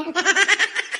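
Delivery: soft high laugh; a brief airy chuckle. Real, not acted. Teller laughs softly at own punchline.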